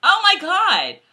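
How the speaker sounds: high, loud exclamation; real, emphatic outcry at an omission; excited protest. Real, not acted. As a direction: burst in, pitch leaping up. Protesting that Jenny was skipped.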